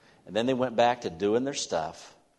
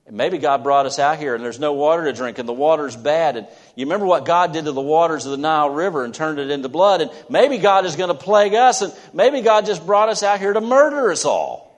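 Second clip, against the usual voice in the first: loud, high, rising indignant complaint; grumbling complaint; 5 semitones higher, 10 dB louder than usual. Acted. Israelites in the desert accusing God, loud and rising complaint. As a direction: build from grumbling to a fearful outcry.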